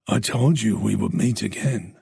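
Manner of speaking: low, menacing voice; the stalker taunting Tess; sinister stranger. Acted.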